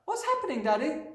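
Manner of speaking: put-on very high child's voice; small high voice questioning a parent; bewildered little girl. A narrator's reading. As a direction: pitch it up like a puzzled little girl. Girl questions frantic father.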